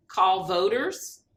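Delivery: usual speaking voice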